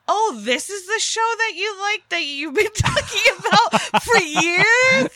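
high, loud mock of partner's reaction; imitating partner. Teasing partner about finally watching the show, hammed-up imitation. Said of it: "he actually was like".

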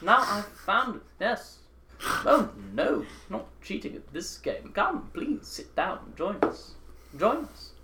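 high, airy courtly villain voice; Goblin King voice. Acted. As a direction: invite them in sweetly.

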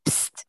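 sharp unvoiced psst; ghostly attention-getting psst; imitated psst. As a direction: hiss a quick psst for attention. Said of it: "somebody in the room went".

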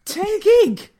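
very high, loud squeak; hammed-up shrieked question; mock outrage. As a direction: squeal it in comic disbelief. Voicing the soul's file size, joking.

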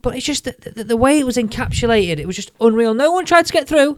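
high, lively rave about the crowd; excited. Real, not acted. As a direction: gush about how unreal it was. Raving about golf fans.

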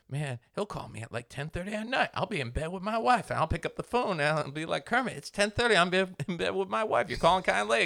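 high whiny put-upon voice; imitating James. Mimicking James griping about late calls from Kermit. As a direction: complain in a high whine. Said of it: "'Cause he's like".

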